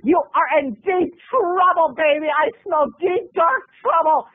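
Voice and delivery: high, campy indignant voice; outraged mom doing John Waters. Retold or imitated. Mom rejects the feng shui book. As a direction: camp it up with menace. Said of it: "my mom was like".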